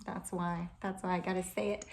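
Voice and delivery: ordinary talk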